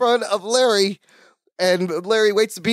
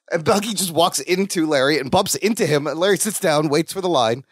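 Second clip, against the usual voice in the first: ordinary talk, nothing marked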